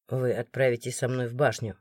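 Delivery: low, quiet order; deep, strict official voice; stern guard. A narrator's reading. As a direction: command gravely, allowing no argument. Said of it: "всё так же строго продолжил Морган".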